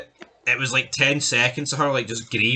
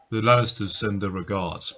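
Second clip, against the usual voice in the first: low, ominous quote; a hint of menace, pitched down; lightly voiced killer; 6 semitones lower, 3 dB quieter than usual. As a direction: drop lower for a villain's parting line. Quotes the killer's line while retelling.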